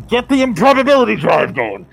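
high, loud shouted command; Badass T. Captain ordering his crew, loud high shout. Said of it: "And then Badass T's like".

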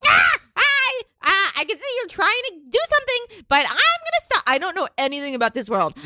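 squeaky high mushroom voice, fast; hurried tiny falsetto pleading; squeaky falsetto mockery. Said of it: "I'll be like".

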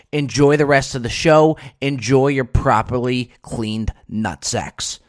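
loud, punchy sponsor read. Acted. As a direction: sell it like a radio spot.

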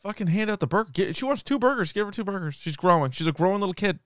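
high, exasperated outburst; indignant rant. Real, not acted. Indignant demand about burgers.

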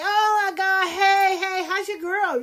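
very high, put-on flirty squeal of hello; hammed-up, high-pitched sociable gushing; mock gushing greeting. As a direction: imitate women fawning over passing guys. Imitating women calling out to dudes.

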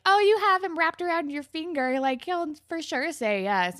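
high, loud gushing tease; teasing girlfriends. Retold or imitated. Friends teasing Terry about Rick, gushing. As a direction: coax her with playful exaggeration.

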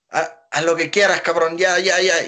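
exasperated giving in. Retold or imitated. Promoter giving in.

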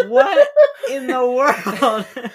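laughing in disbelief; cracking up mid-question. Real, not acted. Laughing at absurd story.

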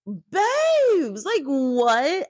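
high, drawn-out exasperated babes. Real, not acted. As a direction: say babes as a high, incredulous wail. Reacting in disbelief.

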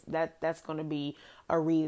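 ordinary delivery

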